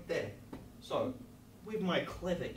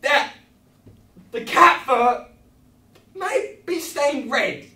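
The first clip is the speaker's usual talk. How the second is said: higher and louder, laughing, hammed-up; manic excitement; 10 semitones higher, 20 dB louder than usual. Real, not acted. Host to kids, manic laughing presentation. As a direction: sell it like a mad host.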